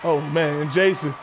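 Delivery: steady ringside call, punchy on each move; live play-by-play. Acted. Commentator calling the match for his viewers, play-by-play. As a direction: call every hit like a ringside announcer.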